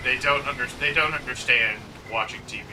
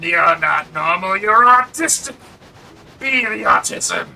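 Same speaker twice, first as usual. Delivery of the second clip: high, loud, slow sinister villain; Green Goblin voice; 7 semitones higher, 10 dB louder, slower than usual. Pretend. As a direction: hiss it like Willem Dafoe's Goblin. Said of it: "William Dafoe and the Green Goblin".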